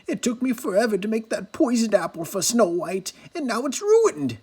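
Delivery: the queen mourning her ruined poisoned apple, bitter rant; wicked queen. A narrator's reading. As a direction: rant like a thwarted witch.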